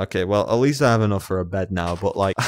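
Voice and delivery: ordinary delivery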